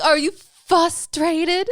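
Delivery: loud, high, drawn-out mocking question; making fun. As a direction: drag out "fustrated" to tease. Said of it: "likes to make fun of me".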